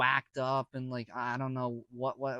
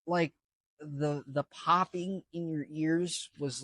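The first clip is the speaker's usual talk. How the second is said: usual speaking voice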